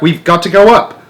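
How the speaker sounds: high, loud shout over wind; yelling. A narrator's reading.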